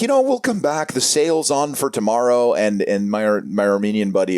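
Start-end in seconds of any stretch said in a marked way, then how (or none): 0.0-2.5 customer voice. Retold or imitated. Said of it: "they were like".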